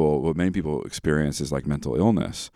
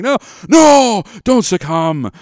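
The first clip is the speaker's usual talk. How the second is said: much higher and louder, frantic no; high loud hammed-up pleading; mock panicked plea; 10 dB louder, slower than usual. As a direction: beg the child not to give in, half joking. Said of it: "And I'm like".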